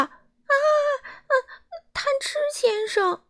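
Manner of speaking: high, loud terrified shriek; frightened scream. A narrator's reading. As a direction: shriek it out in terror. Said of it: "他尖叫道".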